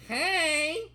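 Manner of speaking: very high, stretched call; high-pitched yell. Acted. Narrator banging on the store doors, high yell. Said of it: "I yelled".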